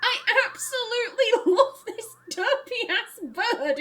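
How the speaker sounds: giggling high through the words; can't stop laughing at the derpy bird. Real, not acted. Player laughing while gushing over a silly bird, laughing.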